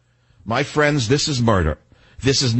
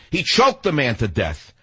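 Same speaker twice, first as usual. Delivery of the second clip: ordinary talk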